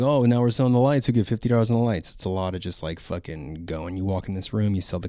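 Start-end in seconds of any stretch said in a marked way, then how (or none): 0.0-2.1 auctioneer. Shown as a demonstration. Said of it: "a little bit of an auction voice".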